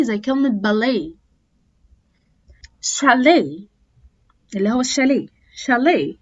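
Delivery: "ballet", "gourmet" said with no final t; silent final t. Shown as a demonstration. Teacher modelling words with a silent final t. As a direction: drop the final t. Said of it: "ما بنطقهاش".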